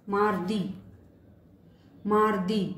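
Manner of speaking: mardi, French r; French word demo. Shown as a demonstration. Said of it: "example है". (मारदी French "mardi" की तरह)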